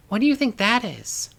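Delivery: put-on high small voice of a young girl, curious; girl asking her friend about the door; little girl voice. A narrator's reading.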